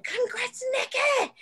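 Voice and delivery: high-pitched playful cheer; gushing congratulations. Retold or imitated. Retold congratulating Nikki.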